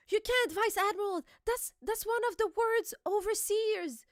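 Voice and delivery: high-pitched, acted protest; pleading alarm. Acting Marines holding back Garp, high mock alarm. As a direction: plead high, trying to stop him.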